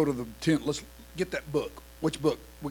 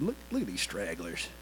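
sneering onlooker; 7 semitones lower, 6 dB quieter than usual. Acted. Mocking Jericho onlookers.

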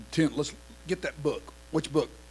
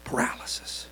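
dramatic whisper; 8 semitones higher than usual. Real, not acted. Preacher hushes the church with one word, whispering. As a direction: breathe the word in a hush.

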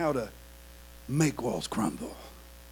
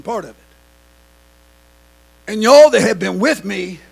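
rising preaching; 7 semitones higher, 13 dB louder than usual. Real, not acted. Preacher rises in force.

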